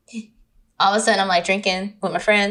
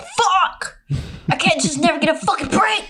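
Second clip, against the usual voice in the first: high-pitched mock despair; high, squeezed cry of exasperation; exasperated outburst; 9 semitones higher than usual. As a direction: wail it in comic frustration. Acting out dismay at the discovery.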